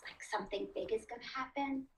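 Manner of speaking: soft and quick; hushed build-up; hushed suspense. Shown as a demonstration. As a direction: drop your voice as if hinting at a surprise. Illustrates suspense of an ellipsis.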